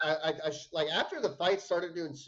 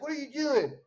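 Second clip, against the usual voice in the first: higher, sharp mock confrontation; mock angry challenge; 9 semitones higher than usual. Brother bursting in on the fight, mock angry.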